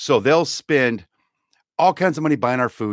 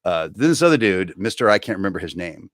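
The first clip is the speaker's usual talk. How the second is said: ordinary talk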